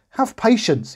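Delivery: very high pleading plea; pleading cry. A narrator's reading. The second servant pleading on his knees. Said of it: "he cried".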